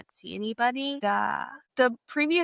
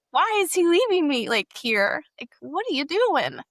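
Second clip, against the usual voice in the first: high, loud distraught protest; retold weeping outburst; 7 semitones higher, 4 dB louder than usual. Girl left behind at the movie theater, retold distraught cry. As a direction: cry out in bewildered hurt, without real tears. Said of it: "I just started, like, weeping".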